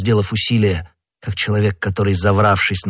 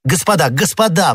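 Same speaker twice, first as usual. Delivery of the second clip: high, lively call for attention; lightly acted urgent exclamation; exclaiming; 9 semitones higher, 3 dB louder than usual. A narrator's reading. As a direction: call the friends to order, pitched well up. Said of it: "воскликнул Д'Артаньян".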